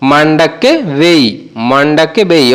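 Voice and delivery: মান্ডাকে বেই said slowly, syllable by syllable; slow teaching model of মান্ডাকে বেই; slow demo. Shown as a demonstration. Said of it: "তখন বলবো".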